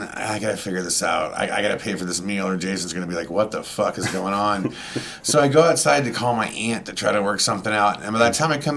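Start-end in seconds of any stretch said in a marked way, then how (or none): 0.0-4.7 quiet inner panic. Retold or imitated.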